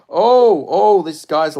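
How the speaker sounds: loud, high yelp that settles into loud talk; excited shout. Real, not acted. Viewer reacting to big shots landing, excited yelp. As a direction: burst out as the shots land.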